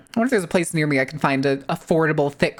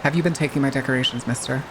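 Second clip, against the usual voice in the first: low, quick mock interrogation; mock stern scolding; 4 semitones lower, faster than usual. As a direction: drop the pitch and playfully scold. Repeats the accusation as mock scolding.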